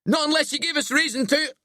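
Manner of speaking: high, called-out tease; joking taunt. A narrator's reading. Locke teases Billy as he hangs, joking. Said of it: "Locke jokes".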